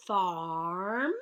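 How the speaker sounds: "Farm" with the R drawn out; stretched out sounds. Shown as a demonstration. Teacher sounding out the syllable for spellers, stretched. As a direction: stretch "Farm" so the R pattern is heard. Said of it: "Tap it out".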